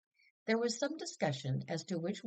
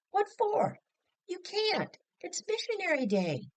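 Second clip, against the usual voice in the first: much higher and louder, rapid-fire objections; shocked protest; 4 dB louder than usual. Acted. Family objecting to Mother's trip, high shocked outcry. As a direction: fire the protests back like shrapnel. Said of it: "came the shrapnel return".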